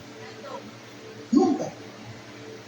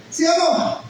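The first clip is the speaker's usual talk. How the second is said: higher-pitched prompt to the crowd; raised, insistent check-in; pressing the congregation; 6 semitones higher, slower than usual. Real, not acted. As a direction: call for an answer from the pews. Preacher pressing congregation for a response.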